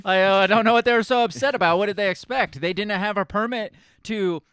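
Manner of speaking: fast, loud mock innocence; sarcastic. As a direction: rattle off the excuses sarcastically. Mocking police excuses.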